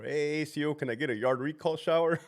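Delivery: lightly voiced inmate asking for a shower; inmate calling out. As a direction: holler to the officer from the cell. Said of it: "working, like, Charlie two floor".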